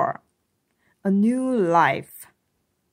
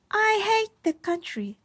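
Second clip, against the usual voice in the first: put-on high child's voice, a mild pout; higher, pouting girl; sulky little girl; 12 semitones higher than usual. A narrator's reading. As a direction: complain like a lonely kid. Narrator voicing Iris complaining.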